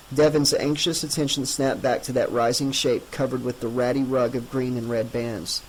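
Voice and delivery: plain reading voice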